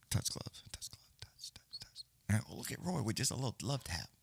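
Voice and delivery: hushed whisper. Real, not acted. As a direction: drop to a breathy whisper as the fighters touch gloves. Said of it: "I think I should whisper".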